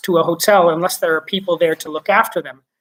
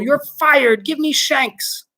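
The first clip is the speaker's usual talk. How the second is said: shouted; 8 semitones higher, slower than usual. Acted. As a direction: fire him on the spot. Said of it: "Shuckworth shouted ground control".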